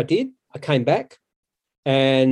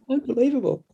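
laughing it out, higher; 12 semitones higher than usual. Real, not acted. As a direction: chuckle through the word, amused at himself. Laughing at his own antics.

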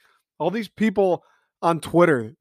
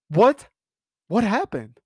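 surprised; 6 semitones higher than usual. Pretend. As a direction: gasp it out in shock. Mock disbelief at sacks.

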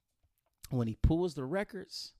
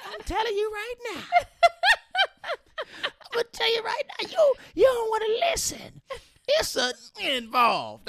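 much higher, loud squealing mom impression; mother's voice; 7 dB louder than usual. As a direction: shriek it like an outraged mother. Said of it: "So my mother's like".

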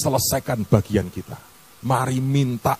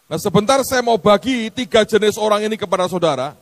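impassioned sermon voice, raised high; fiery preaching; 11 semitones higher than usual. Acted. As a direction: preach it hard to the congregation.

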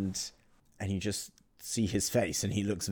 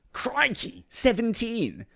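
much higher, astonished squeal; shocked exclaim; slower than usual. Retold or imitated. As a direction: yelp it out in mock disbelief. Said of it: "and he goes".

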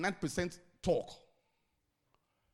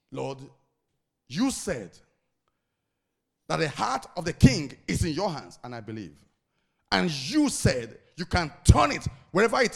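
loud, pleading prayer to God; fervent prayer; 12 dB louder than usual. Shown as a demonstration. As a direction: pray it out boldly with force. Preacher demonstrates a fervent prayer.

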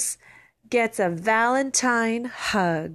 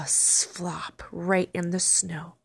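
plain reading voice

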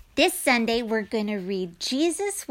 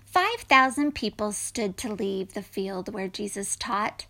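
ordinary delivery

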